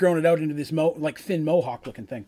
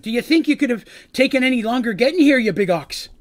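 put-on gruff, raised Scottish accent, a bit higher; 5 semitones higher, 5 dB louder than usual. GM voicing Fig teasing his late friends, Scottish accent. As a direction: rib an old friend in a Scottish brogue. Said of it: "he speaks in a Scottish accent".

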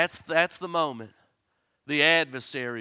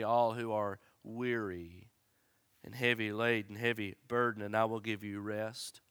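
tender quiet preaching; 5 semitones lower, 5 dB quieter than usual. Acted. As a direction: lay it down gently like a lullaby. Soft, low tender preaching.

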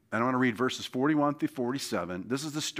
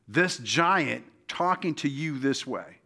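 ordinary talk, nothing marked

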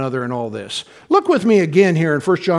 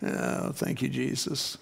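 very soft, low murmur; hushed thanks; 4 semitones lower, 14 dB quieter, slower than usual. Real, not acted. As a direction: murmur a private prayer of thanks. Murmured aside of thanks after laughing.

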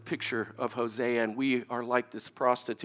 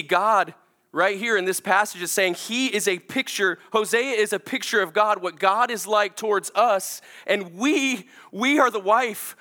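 louder, higher preaching voice, pressing each point; impassioned sermon climax; preaching at full force; 9 semitones higher, 5 dB louder than usual. Acted. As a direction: preach it out with rising fervour.

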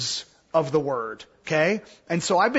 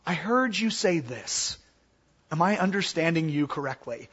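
soft, lowered, checking voice; calm summarizing; 3 semitones lower, 5 dB quieter than usual. Shown as a demonstration. Teacher models summarizing to an agitated person, calm and soft. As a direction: gently check you have understood. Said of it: "it's really easy to just summarize".

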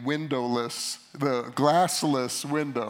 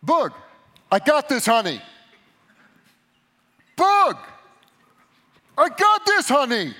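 much higher and louder, building in alarm; wife's warning growing more urgent; rising alarmed call; 12 semitones higher, 11 dB louder than usual. Retold or imitated. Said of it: "I'm sorry, I yelled".

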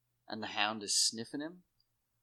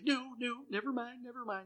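much higher, panicky backpedalling; mock grovelling; 13 semitones higher than usual. The toady begging Jon to forget it, mock panicky. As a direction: squeak out a hasty retreat.

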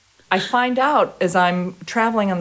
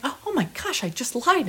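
hushed shock; 6 semitones higher, 6 dB quieter, faster than usual. Retold or imitated. Realizing she lied.

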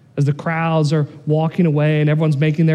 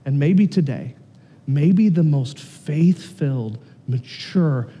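tender exhortation; 5 semitones lower, 4 dB quieter than usual. Acted. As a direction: gently lay it on their hearts.

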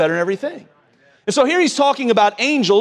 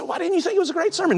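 puzzled aide; 9 semitones higher, 4 dB quieter, faster than usual. Retold or imitated. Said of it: "He said".

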